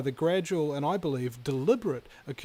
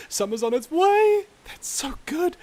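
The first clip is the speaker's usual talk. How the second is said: excited; 13 semitones higher, 6 dB louder than usual. Real, not acted. Host cheering coming summer.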